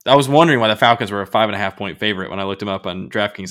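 ordinary talk

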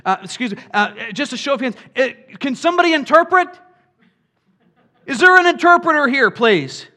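the apostles' moderator trying to restore order; mock church moderator. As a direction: play an officious chairman calming the room. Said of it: "were like".

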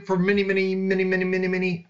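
rapid-fire repetition on a held pitch; fast talk. Shown as a demonstration. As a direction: rattle off the repeated word quickly. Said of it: "I say that really fast".

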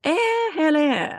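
slow, high posh British "eh, hello"; drawn-out mock upper crust English greeting. Showing the upper crust way, slow posh British. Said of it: "the upper crust way".

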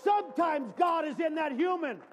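loud, high preaching shout; full-force sermon shout; preaching hard. Real, not acted. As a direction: drive it home to the church.

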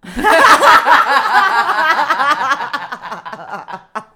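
loud, high-pitched burst of laughter. Real, not acted. Host cracking up at co-host's candid confession, loud laughter.

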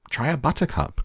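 high, bright call to try it; eager cry. A narrator's reading. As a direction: urge them on, delighted. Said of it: "cried Mister Wonka".

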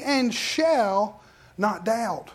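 and shall not doubt, drawn out very slowly; slow emphasis. Shown as a demonstration. Preacher stressing the scripture to the congregation, very slow. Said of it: "that's what Mark eleven twenty-three says".

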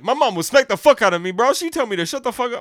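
mimicking David bragging about his strict mom, loud; imitating David. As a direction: play the tough friend bragging loudly. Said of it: "he'd be like".

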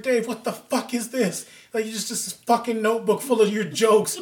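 high, loud retold scolding; exasperated mock telling-off; mock mad wife. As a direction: imitate an angry wife confronting her husband. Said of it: "she was all mad".

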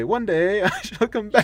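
slow and high, a lofty parting vow; grand Doctor farewell. Retold or imitated. Old man leaving the table, a slow grand farewell. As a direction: promise your return like a stately old wanderer. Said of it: "and he'd say".

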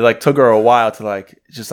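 normal talking voice

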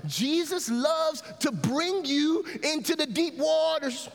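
high, stretched-out preacher's cry; fervent pulpit delivery; impassioned preaching. Real, not acted. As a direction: proclaim it slowly with lifted voice. Preaches at full force.

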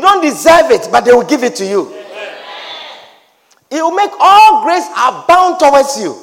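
loud, rising sermon cries on grace; preaching at full force. Acted. Preacher to his congregation, fervent loud preaching.

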